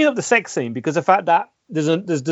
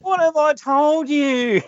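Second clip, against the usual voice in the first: high, slow broad Aussie drawl; Australian ocker voice; 11 semitones higher, much slower than usual. Retold or imitated. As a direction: stretch it out like a rough Aussie bloke. Quoting the ocker character again.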